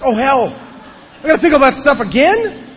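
high, loud mock dismay; dismayed exclamation. Sober member learning he must revisit his burned list, loud high mock dismay.

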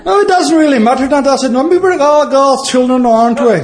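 high, sweet, doting voice; Irish drunk. Acted. Irish drunk cooing tenderly at a fly in his beer, high and sweet. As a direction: coo at the fly like a little boy. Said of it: "looks at the beer and goes".

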